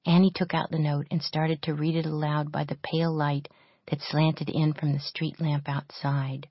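ordinary delivery, nothing marked

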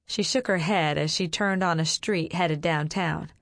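plain reading voice

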